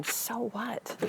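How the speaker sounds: quiet self-question. Shown as a demonstration. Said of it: "really ask yourself this question".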